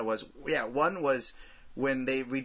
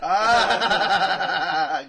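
burst of laughter; 4 semitones higher, 10 dB louder than usual. Real, not acted. Group laughs at a joke.

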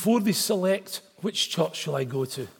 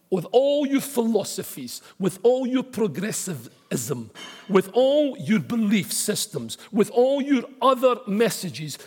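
rising preaching build; 5 semitones higher, 3 dB louder than usual. Real, not acted. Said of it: "preaching build".

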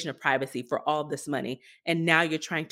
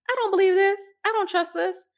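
much higher and louder, exasperated; real disbelief; 13 semitones higher, 4 dB louder, faster than usual. Real, not acted. Distrust of Clearview's data.

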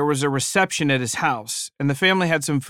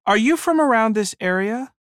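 polite lady; 8 semitones higher than usual. Retold or imitated. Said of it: "helping herself to some more chips".

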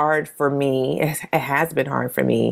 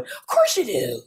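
high, insistent cheering; imitating an adoring child's encouragement; Taj; 8 semitones higher than usual. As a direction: brush off the doubt in a high cheerleader voice. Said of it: "Taj is just cheering on".

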